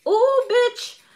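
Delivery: high, sharp ooh; high mock outrage. Real, not acted. Reader imagining the listener's outrage, high squeal.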